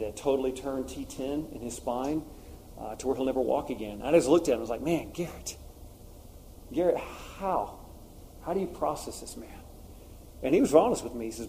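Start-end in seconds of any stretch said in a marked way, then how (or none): 4.8-9.5 softer, halting, stunned question; retold moment of quiet astonishment; hushed disbelief. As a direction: ask it slowly, at a loss for words.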